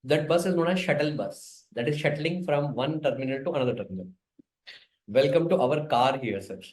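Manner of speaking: ordinary talk, nothing marked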